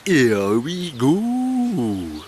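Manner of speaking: put-on slow drawn-out Welsh accent; [name]'s Welsh accent. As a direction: stretch it out in a Welsh lilt. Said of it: "in a Welsh accent".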